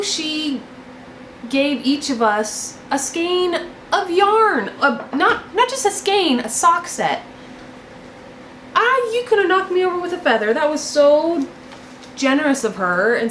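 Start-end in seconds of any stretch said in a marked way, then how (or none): none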